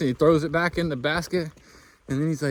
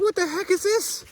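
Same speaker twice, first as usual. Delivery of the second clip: high-pitched incredulous question; retold disbelief; 14 semitones higher than usual. Hiker to the hunter handing her grouse, high incredulous squeak. Handed a raw grouse breast.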